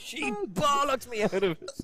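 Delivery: high, drawn-out Cockney; Cockney accent. Acted. Guest telling of the wife's telling-off, put-on Cockney accent. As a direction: stretch it out in East End style.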